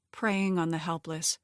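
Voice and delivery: usual speaking voice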